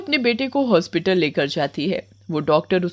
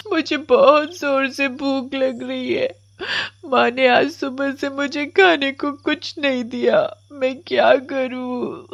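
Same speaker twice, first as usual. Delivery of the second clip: put-on high, weepy child's wail; sobbing hard; 6 semitones higher than usual. Komal, starved since morning, cries under the tree, sobbing. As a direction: draw the words out through tears. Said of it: "बहुत जोर जोर से रो रही होती है".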